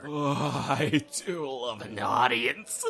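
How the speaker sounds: slow, smug line that breaks into a breathy laugh; laughing smugly. Acted. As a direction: savour it, then laugh it off. Mocking the threat, laughing.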